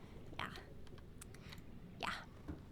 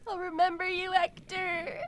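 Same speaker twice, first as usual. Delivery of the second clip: louder, higher tearful vow; crying; 5 semitones higher, 4 dB louder than usual. Real, not acted. Streamer's farewell to Hector, crying. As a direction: call it out through tears. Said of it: "I'm actually crying".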